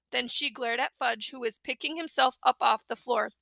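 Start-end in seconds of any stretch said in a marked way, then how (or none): none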